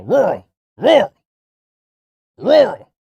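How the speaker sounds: high, loud yelping barks of the word; barking dog. Acted. Streamer mocking rural voters as barking dogs. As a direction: bark "Rural" like a yapping dog. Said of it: "It's like they're fucking barking".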